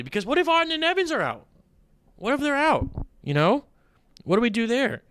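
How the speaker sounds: very high-pitched, agitated what-ifs; exasperated, high-pitched hand-wringing; worried fretting. Real, not acted. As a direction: fret aloud in a squeaky high voice. Frets over making picks so early.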